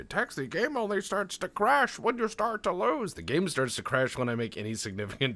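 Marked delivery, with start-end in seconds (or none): 0.0-3.1 high, loud whiny-critic imitation; mocking viewer voice. Streamer quoting a viewer's complaint, high mocking voice. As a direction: mimic a nitpicking commenter in a high voice. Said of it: "I know someone's gonna say".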